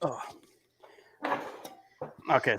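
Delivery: ordinary talk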